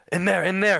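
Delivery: high, loud, panicked urging; frantic pointing cry. Acted. Injured stranger urges the party toward the woods, panicked shouting.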